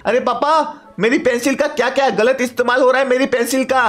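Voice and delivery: son's high voice, mildly indignant; complaining son. Acted. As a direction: play a kid protesting to papa.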